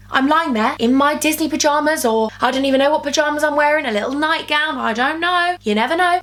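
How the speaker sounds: ordinary talk, nothing marked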